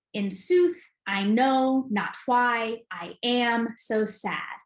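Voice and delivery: slow da-DUM beat, punching "sooth", "know", "why", "am", "sad"; overemphasized iambic stress. Shown as a demonstration. Teacher scanning a Merchant of Venice line, overemphasized iambic stress. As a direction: lean hard on every second syllable of "In sooth, I know not why I am so sad.". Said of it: "overemphasizing the stress pattern".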